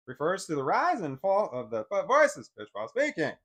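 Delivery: loud, swooping rise and fall on "rise", "fall" and "voice's"; exaggerated intonation. Shown as a demonstration. Acts out pitch rising and falling.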